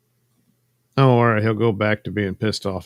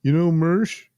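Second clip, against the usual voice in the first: higher, louder mock street shout; calling out; 10 semitones higher, 5 dB louder than usual. Crazy guy asking random people on the street, mock calling out. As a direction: call it out to passers-by, pitched up. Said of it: "calling out to random people on the street".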